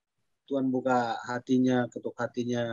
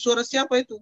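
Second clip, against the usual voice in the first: sharp, much higher, louder jealous question; suspicious wife; 9 semitones higher, 4 dB louder than usual. Retold or imitated. Wife hearing a woman answer the phone, jealous higher demand. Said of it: "Jadi dia bilang".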